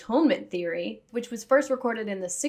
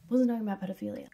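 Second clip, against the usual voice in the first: fast, unvoiced whisper under her breath; whispered aside; 5 dB quieter, much faster than usual. Whispering a quick aside to viewers. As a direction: slip it in as a quick secret.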